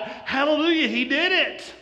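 high, exultant acted cheer; mock jubilation. What Mary did not think at the tomb, jubilant cheer.

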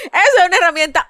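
laughing till near tears; laughing hard. Real, not acted. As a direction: crack up while speaking. Laughing fit at joke.

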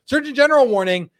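loud, high announcer call; mock official announcement. Host announces a parody label to viewers, mock official announcement. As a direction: proclaim it like an official alert.

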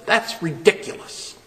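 a breathy scoffing whisper; mock dismissive. Shown as a demonstration. Preacher voicing a wrong dismissive God, scoffing whisper. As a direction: brush him off mockingly. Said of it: "No, that's not what he said".

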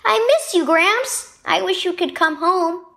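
put-on a boy begging his sick grandpa to come home; boy Asher missing Gramps. A narrator's reading. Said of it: "Asher gave Grandpa a shaky smile".

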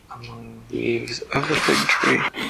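plain reading voice

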